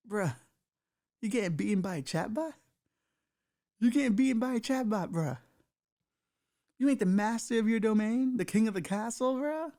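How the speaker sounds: a lawyer laughing at his client, jeering; mocking laughing lawyer. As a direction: laugh in his face while you mock him. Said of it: "the lawyer laughs in his face, going".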